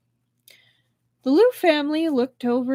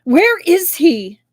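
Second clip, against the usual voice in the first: slow, higher and loud furious demand; fierce demand; 7 semitones higher, 9 dB louder, slower than usual. A narrator's reading. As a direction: hurl the question mid-fight. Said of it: "as she demanded".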